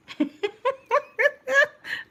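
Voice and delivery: long helpless high-pitched laughter; laughing at how funny it is. Real, not acted. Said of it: "This is too funny".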